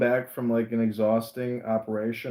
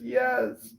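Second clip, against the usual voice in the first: high, plaintive "yes"; mock tearful mother; 12 semitones higher than usual. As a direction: imitate a weepy mother answering. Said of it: "there's a woman crying in the stands".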